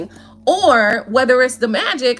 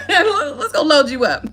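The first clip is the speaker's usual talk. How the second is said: laughing; 3 semitones higher, slower than usual. Retold or imitated. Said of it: "sitting there studying while they was".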